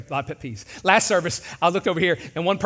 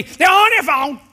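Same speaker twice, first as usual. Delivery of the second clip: loud, high exasperated gripe at the car ahead; frustrated complaint; 9 semitones higher, 8 dB louder, slower than usual. Retold or imitated. Annoyed husband griping to his wife about the driver. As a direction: complain loudly at the stalled driver. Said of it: "she knows she's about to hear from me".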